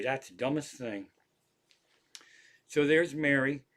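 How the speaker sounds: ordinary delivery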